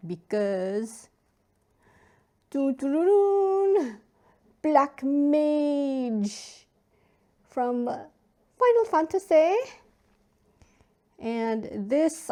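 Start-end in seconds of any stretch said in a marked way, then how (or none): 2.5-9.6 high, loud, drawn-out ta-da announcement; playful, gleeful fanfare, voice pitched way up; dramatic reveal fanfare. Pretend. Unboxer unveiling a favourite Final Fantasy card, dramatic reveal.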